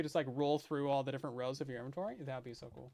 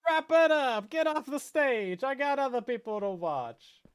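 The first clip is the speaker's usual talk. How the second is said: loud, high booing heckler; heckling from crowd; 7 semitones higher, 6 dB louder, slower than usual. Pretend. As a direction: yell at the performer to leave.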